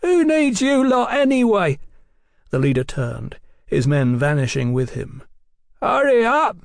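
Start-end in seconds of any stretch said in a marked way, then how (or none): 0.0-1.8 high, loud jeer; high-pitched bravado; defiant shout. A narrator's reading. Nervous lad shouts defiance. 5.8-6.6 high, loud parting bellow; forceful shouted parting shot. A narrator's reading. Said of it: "bellowed as a parting shot".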